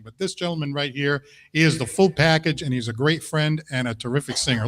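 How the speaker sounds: usual speaking voice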